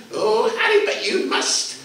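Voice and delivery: put-on high, laughing old woman insisting. Old woman insisting Harry must carry her, high with a laugh. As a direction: coax him with a cackle.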